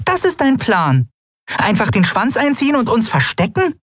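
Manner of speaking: "Das ist dein Plan?" stressed on ist, falling, very high; high scornful falling reproach; indignant reproach. A narrator's reading. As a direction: scold sharply in a high voice. Nelen indignant.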